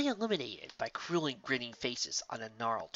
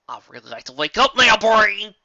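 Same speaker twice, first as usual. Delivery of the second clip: desperate shout; 8 semitones higher, 14 dB louder than usual. Acted. Pleading with her own brain.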